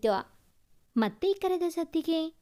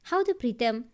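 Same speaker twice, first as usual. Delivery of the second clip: usual speaking voice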